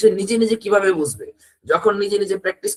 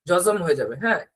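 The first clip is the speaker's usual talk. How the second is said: ordinary talk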